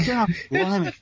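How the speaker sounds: normal talking voice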